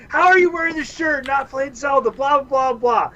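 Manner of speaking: high-pitched imitation of an indignant rant; mock yelling. Clerk berating him over the Zelda shirt, mock yelling. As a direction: rant it high, tailing into blahs. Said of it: "just starts yelling at me".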